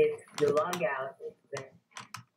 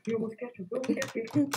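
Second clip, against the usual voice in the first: high, rising near-miss cries; excited alarm; 11 semitones higher, 4 dB louder, faster than usual. Real, not acted. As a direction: gasp then cry out. Near catch in game.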